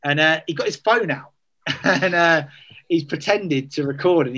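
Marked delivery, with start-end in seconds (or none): none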